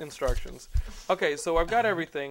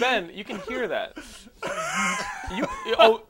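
laughing; 7 semitones higher, 4 dB louder than usual. Real, not acted. Catching Ben texting mid-show.

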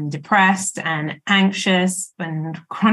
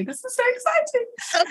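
excited squeal; 4 dB quieter than usual. Real, not acted. Guest thrilled to be invited.

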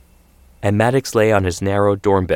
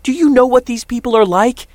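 high, loud angry yell; yelling father; 5 dB louder than usual. Acted. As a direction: yell it at your son. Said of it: "his father yelled".

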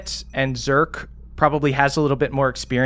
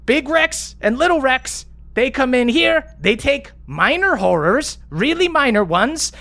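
much higher, tumbling defensive explanation; flustered Stella; 11 semitones higher than usual. Acted. Stella justifying the crew deal to accusing players, flustered and high. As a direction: squeak out the excuses.

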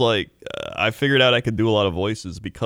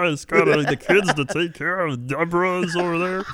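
higher, reluctant sitcom dad; Ray Romano; 8 semitones higher than usual. Retold or imitated. Sitcom husband blaming kids and wife to his pal, whiny impression. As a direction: make excuses in a put-on husband voice. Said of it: "surprisingly accurate Ray M-, Romano".

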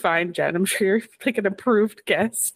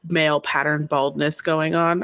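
normal talking voice

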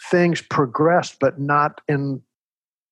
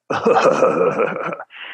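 mock chuckle, low and rolling; low imitation laugh; imitated principal's laugh; 7 semitones lower than usual. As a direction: put on the principal's self-satisfied laugh. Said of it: "And he laughs".

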